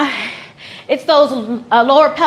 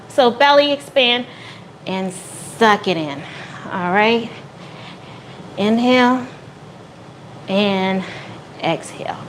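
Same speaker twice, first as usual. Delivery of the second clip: calm breathing cues; 4 semitones lower, 6 dB quieter than usual. Shown as a demonstration. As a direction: guide each breath gently, fading away. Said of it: "demonstrates breathing for the Kegel exercise".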